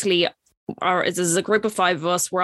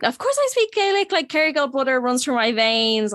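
jumps much higher on "Of course", then settles; hammed-up eager boast of Irishness; mock proud Irish boast; 6 semitones higher than usual. Telling wedding guests she speaks Gaelic, hammed-up boast. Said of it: "And I was like".

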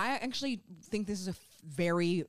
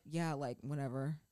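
soft, lower, offhand shrug; retold nonchalance; 6 semitones lower, 7 dB quieter than usual. Co-host imitating an actor's attitude to lessons, offhand and soft. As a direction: toss it off as if it's nothing.

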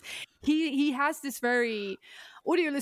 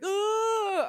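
high crying outcry; a short, high, half-joking yell; mock wail; 11 semitones higher than usual. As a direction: squeal like a frightened inmate. Crazy asylum inmates crying out.

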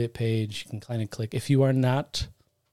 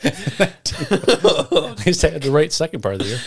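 higher, louder laughing; 4 semitones higher, 6 dB louder than usual. Real, not acted. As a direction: say it through a laugh. Laughing at own mistake.

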